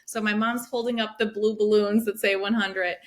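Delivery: ordinary talk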